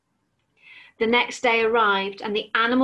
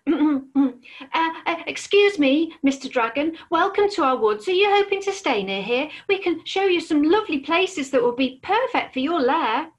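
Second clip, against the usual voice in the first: higher, hesitant little squirrel voice; nervous squirrel; 6 semitones higher than usual. Acted. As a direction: stammer politely, scared of the dragon. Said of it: "looked at the scary dragon and gulped".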